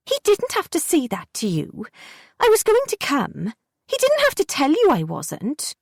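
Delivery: put-on high, indignant child's voice; a hurt kid protesting; sulky girl Jessie. A narrator's reading.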